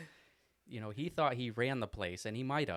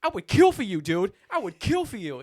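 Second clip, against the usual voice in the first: very high, loud, fierce loyal shout; Corey yelling at the old lady in line; screaming devotion; 10 semitones higher, 8 dB louder than usual. Retold or imitated. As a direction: swear loyalty at the top of his lungs. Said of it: "screaming at me, telling how much he loves me".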